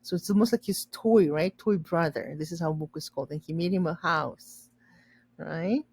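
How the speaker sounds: ordinary talk, nothing marked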